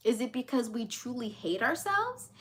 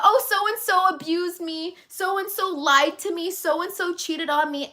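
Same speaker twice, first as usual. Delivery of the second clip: higher, slow whiny complaint; mimicking someone moaning about being wronged; mock complaining; 6 semitones higher, 4 dB louder than usual. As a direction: drag out a victim's grievance in a raised voice. Mocks people complaining about others.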